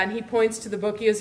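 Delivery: usual speaking voice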